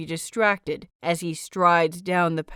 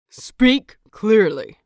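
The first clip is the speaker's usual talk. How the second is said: louder, slow, cutting command; sharp snap; 2 semitones higher, 9 dB louder, slower than usual. A narrator's reading. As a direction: bite off each word like a stern elder. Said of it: "Lan Qiren snaps".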